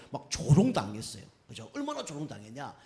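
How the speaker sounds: ordinary talk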